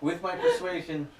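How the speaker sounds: laughing. Real, not acted. Laughing at a bad roll.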